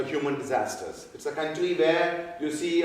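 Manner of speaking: usual speaking voice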